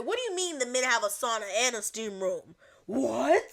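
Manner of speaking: loud outraged questioning, ending on a big "What?"; indignant disbelief. Retold or imitated. Her ears hearing men get both rooms, indignant disbelief. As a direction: act out scandalized protest at full volume. Retelling own outrage at inequality.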